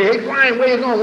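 loud, high-pitched hail; calling out. Acted. Guy in a bar hailing a hustling friend, calling out. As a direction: holler it to catch a passing friend. Barfly hails him in the street.

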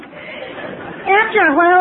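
ordinary talk

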